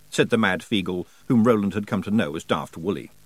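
ordinary delivery, nothing marked

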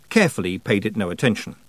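ordinary delivery

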